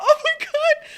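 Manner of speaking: squealing through laughter; laughing. Real, not acted. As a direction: gasp it out laughing. Laughing reaction.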